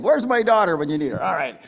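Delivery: ordinary delivery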